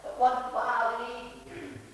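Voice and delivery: ordinary delivery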